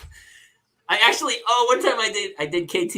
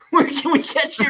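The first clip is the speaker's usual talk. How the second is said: higher, louder quote through laughter; laughing retold question; 11 semitones higher, 7 dB louder than usual. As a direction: crack up while quoting the host. Cracking up retelling host's question.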